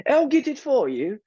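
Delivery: high, prim, super proper voice. Retold or imitated. Mimicking the cashier's super proper British voice. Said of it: "she said it, like, super proper".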